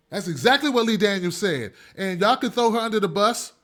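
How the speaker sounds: usual speaking voice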